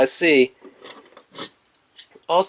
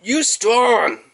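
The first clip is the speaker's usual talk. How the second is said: blunt Grimlock grunt; 9 semitones higher than usual. Retold or imitated. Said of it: "Grimlock was kinda like".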